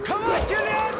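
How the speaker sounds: shouting. Acted. NPC yelling at door.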